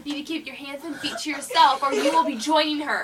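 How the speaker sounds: loud, mock-stern warning, half laughing; mock stern teacher. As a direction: play the strict teacher, barely holding a laugh. Pretend teacher warning boy.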